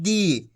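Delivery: दी with a drawn-out ee; long ī. Shown as a demonstration. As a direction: stretch दी on a long vowel. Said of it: "खींच के पढ़ रहे हैं".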